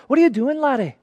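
put-on playful, amateur Scottish accent. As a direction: repeat it in a put-on Scottish brogue. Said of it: "That's my, my best Scottish accent".

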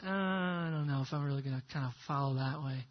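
low, soft, quick reluctant mumble; reluctant hedging. Acted. Believer answering Jesus's instruction, quiet reluctant hedging. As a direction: back away from it quietly, half under your breath.

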